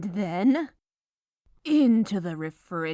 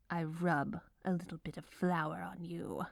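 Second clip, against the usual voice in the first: fast softer whisper; quick soft whisper; 7 dB quieter, faster than usual. Acted. Baker flouring the dough, quick whisper. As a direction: hurry it out in a whisper. Melodramatic baking narration.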